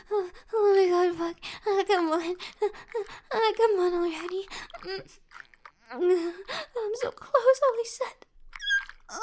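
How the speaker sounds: high, loud, breathless moans; building to climax, pleading; climax moaning. Acted.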